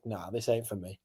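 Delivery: low, very soft retold grumble; deflated. Drinking alone on a night out, deflated. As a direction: sink into a quiet, defeated aside.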